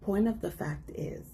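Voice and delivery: whispered aside; hushed whisper. Real, not acted. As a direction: drop to a whisper. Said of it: "whispered aside".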